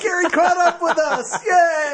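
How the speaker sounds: loud, high-pitched teasing cheer; excited cheer. Real, not acted. Co-host celebrating Gary finally catching up, excited cheer.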